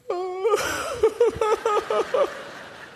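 preacher cracks up, high laughter; long high laugh. Real, not acted. Preacher laughs remembering his crying son on the mound, long high laugh.

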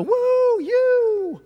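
high, loud, drawn-out whoop; mock cheering. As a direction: whoop like an adoring crowd, hammed up. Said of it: "want people to go".

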